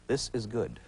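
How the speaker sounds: soft mock contented viewer; quietly pleased, put-on voice; imagined TV viewer. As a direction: murmur it as if alone before the set. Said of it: "the image of you sitting before the T V set going".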